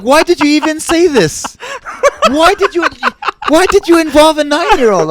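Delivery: high, loud imitation of appalled scolding; mock outrage. Comedian retelling someone's reaction to a joke, mock outrage. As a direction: wail the question in exaggerated indignation.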